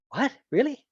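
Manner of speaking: high incredulous what, really; mock astonishment. Lecturer feigning shock at schwa, high disbelief. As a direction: act stunned by the discovery, pitch leaping up.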